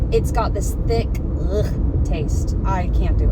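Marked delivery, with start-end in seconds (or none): 1.5-2.0 throaty noise of distaste; disgusted ugh. Real, not acted. Vlogger describing tea's thick taste, disgusted ugh. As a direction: gag slightly at the taste.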